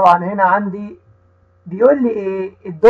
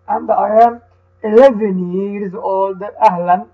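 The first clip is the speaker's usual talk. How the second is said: slow, careful reading with each word set apart for the class to repeat; teacher reads the dialogue slowly so the children can repeat; slow read-aloud for repeating; slower than usual. Shown as a demonstration. Teacher reading slowly to children who repeat after her. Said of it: "هقرأها أنا وهتقول ورايا".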